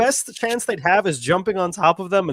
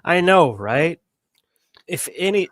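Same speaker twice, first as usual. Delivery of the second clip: normal talking voice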